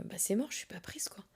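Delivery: quiet, resigned aside to herself; soft defeated murmur; muttered inner thought. Retold or imitated. Retold dejected thought at casting.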